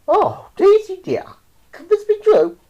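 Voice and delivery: very high, loud cry of wonder; amazed exclamation. A narrator's reading. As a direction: call out to the cow in astonishment. Astonished owner addresses flying cow.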